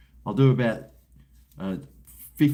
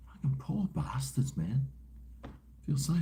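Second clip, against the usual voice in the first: hushed pitying whisper, much softer; 3 semitones higher, 9 dB quieter than usual. Real, not acted. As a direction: breathe it out in sorrowful sympathy. Pity for unpaid Chinese workers.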